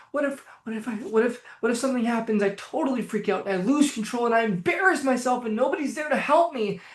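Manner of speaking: acted anxious self-talk, building; anxious inner voice. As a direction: spiral into a mock panicky worry. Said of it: "but in this tone of voice".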